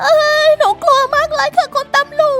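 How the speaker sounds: put-on high, tearful little-girl voice, sobbing to the officer; a frightened girl sobbing to the officer, high and tearful; scared crying girl. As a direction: cry out your fear to the policeman. Frightened girl tells the police.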